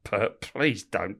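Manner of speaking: slow, drawn-out mock plea; pleading please. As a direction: stretch it out, begging them not to. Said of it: "everyone was like".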